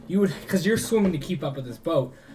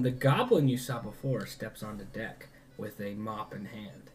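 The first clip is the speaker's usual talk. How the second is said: ordinary delivery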